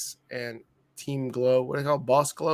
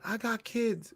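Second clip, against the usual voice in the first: retold plea; 7 semitones higher, 6 dB quieter, slower than usual. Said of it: "he told Brock".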